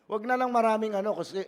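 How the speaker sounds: ordinary talk, nothing marked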